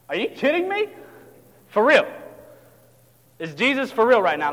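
high rising disbelief, then loud; incredulous. Acted. Disciples reacting to Jesus' order, loud incredulous questioning. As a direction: question it as if it cannot be true.

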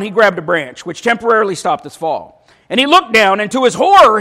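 ordinary talk, nothing marked

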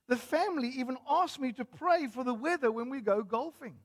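high, gushy imitation of a churchgoer; gushing lady. Lady thanks pastor after the funeral, gushing.

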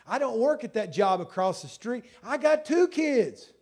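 loud, high-pitched sermon delivery; a preacher pressing his testimony; preaching at full force. Real, not acted. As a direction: hammer each point home to the congregation. Preaching about the changed life.

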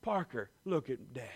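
soft, close and tender; calm a frightened son; gentle dad. Retold or imitated. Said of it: "I get down real close".

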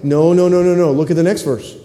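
normal talking voice

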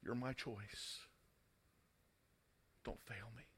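very soft, low-pitched plea; acted quiet entreaty; hushed plea. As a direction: drop almost to nothing, as a father pleading. Father's plea to Jesus, acted.

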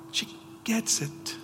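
whispered, hushed and slow; preacher closes the point in a whisper; whispered repeat. Real, not acted. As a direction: breathe it out as a whisper. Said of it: "and she gets it".

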